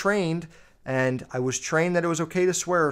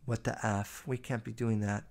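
hushed mom; 4 semitones lower, 11 dB quieter than usual. Pretend. Imagined mom scolding her son over swearing, hushed and stern. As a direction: play the mom scolding in a near-hush. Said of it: "she was like".

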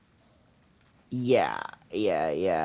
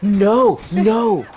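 loud no; 7 semitones higher, 7 dB louder than usual. Real, not acted. Dying again in game.

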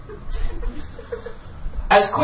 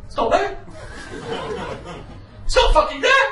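panic; 14 semitones higher than usual. Retold or imitated. Wallet missing, panic rising.

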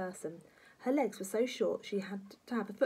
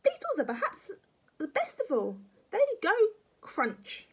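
high, loud granny relish; pitched-up batty old woman; gleeful grandma; 14 semitones higher, 6 dB louder than usual. Acted. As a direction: savour each bit, crunching the last word.

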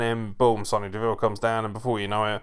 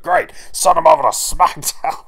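loud, high put-on boss voice; Vince McMahon; 12 semitones higher, 8 dB louder than usual. Boss ordering a team sent to SmackDown, loud caricature. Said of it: "it's almost like Vince McMahon sort of".

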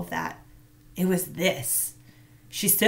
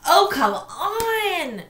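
high, very slow exasperated cry; frustrated exclamation; 11 semitones higher, 5 dB louder, much slower than usual. A narrator's reading. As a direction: drag it out in frustration. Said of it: "she exclaimed".

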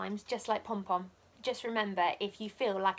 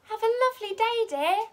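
much higher, loud, quick sing-song mum; cheery mummy; 12 semitones higher, 11 dB louder than usual. A narrator's reading. Mummy waving Pom Pom off, bright sing-song farewell. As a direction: chirp it brightly like a happy mum.

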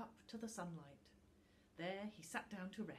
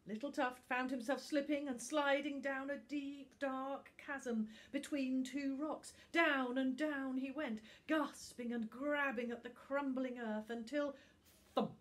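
higher, louder, urgent storytelling; breathless excited narration; 7 semitones higher, 10 dB louder than usual. A narrator's reading. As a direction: tumble through it, breathless. Tense fall down a chasm.

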